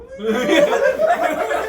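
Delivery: squealing laugh; helpless high-pitched giggle; high squeal of laughter. Real, not acted. Laughing at leech wiggling.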